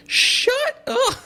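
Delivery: very high, squawked mock yell; laughing, high-pitched mock outburst; yelling kid clip imitation. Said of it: "kid slapping his mom and Doctor Phil, being like".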